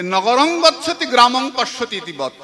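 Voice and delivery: slow, loud, intoned recital of the Sanskrit verse; scripture recitation. Shown as a demonstration. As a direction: intone the scripture slowly and loudly, every word weighted. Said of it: "শাস্ত্র বললেন যে". (ধীরে, জোরে, শাস্ত্রের শ্লোক আবৃত্তি)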